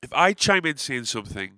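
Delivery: ordinary talk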